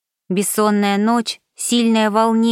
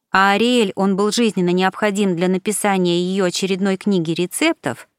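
ordinary delivery

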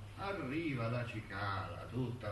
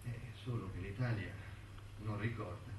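usual speaking voice

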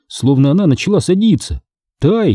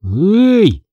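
ordinary delivery, nothing marked

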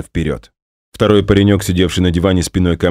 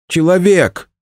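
warning shout; 13 semitones higher, 4 dB louder, slower than usual. Acted.

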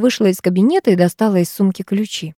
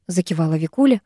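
ordinary delivery, nothing marked

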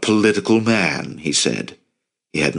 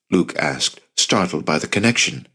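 plain reading voice

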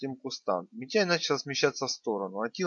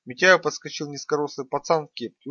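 ordinary delivery

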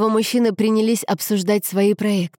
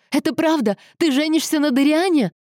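high-pitched, urgent questioning; agitated, pleading disbelief; distressed demand; 9 semitones higher than usual. A narrator's reading. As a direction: confront a fiancé, desperate for the truth. Tearful confrontation of the fiancé.

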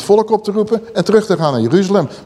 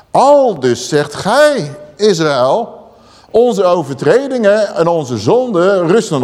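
scripture reading; 4 semitones higher, slower than usual. Acted. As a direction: proclaim the verse slowly like a prophet. Reads Ezekiel aloud. (plechtig voorgelezen bijbeltekst)

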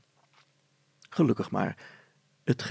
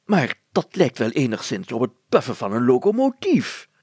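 louder exclamation; a hint of surprise; lightly voiced amazed mayor; 8 dB louder than usual. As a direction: compare it to a train.

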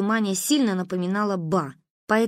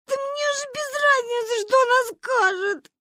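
put-on very high, tearful child's wail; bawling. A narrator's reading. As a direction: howl it out defiantly. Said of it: "заревела Маня".

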